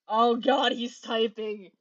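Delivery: loud hammed-up excitement at "he's typing"; over-excited. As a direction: overplay the thrill, loud. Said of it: "I'm over-exaggerating this right now".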